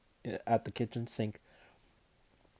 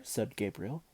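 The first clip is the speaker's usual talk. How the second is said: ordinary delivery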